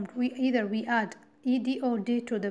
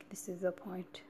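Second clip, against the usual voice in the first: whispering; 4 semitones lower, 13 dB quieter than usual. Shown as a demonstration. Teacher whispers the key point.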